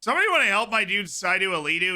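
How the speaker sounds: urgent call for a medic. Real, not acted. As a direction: shout it out across the room. Player down injured, calling out.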